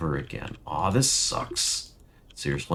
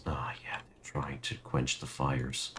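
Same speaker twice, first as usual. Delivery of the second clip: annoyed whisper; 4 semitones lower, 11 dB quieter than usual. Real, not acted. Streamer grumbling at his dying disciples, whispered. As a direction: hush it, grumbling to yourself.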